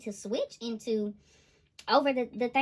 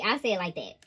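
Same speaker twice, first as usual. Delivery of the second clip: normal talking voice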